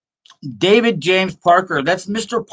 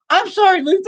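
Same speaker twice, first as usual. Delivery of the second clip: much higher, shouted through laughter; laughing through the quote. Retold or imitated. Retelling the girl's reply, cracking up with laughter. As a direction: blurt the punchline while cracking up. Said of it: "looks at me and says".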